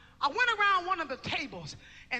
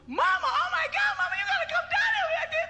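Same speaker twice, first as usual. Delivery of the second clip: much higher, frantic, half-shouting; panicked call; 13 semitones higher, 3 dB louder than usual. Retold or imitated. Mother voicing her son's frantic phone call, high panicked shout. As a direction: cry out for Mama in terror.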